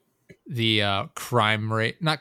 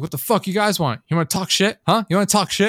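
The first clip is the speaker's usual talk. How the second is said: much higher, taunting mock yell; imitated angry yelling; 9 semitones higher, 5 dB louder than usual. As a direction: heckle strangers from across the street. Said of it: "this guy was yelling at us".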